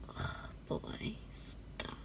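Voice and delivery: hushed mock plea; whispered. Real, not acted. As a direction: whisper stop, overwhelmed. Overwhelmed by photos.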